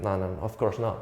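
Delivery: ordinary talk, nothing marked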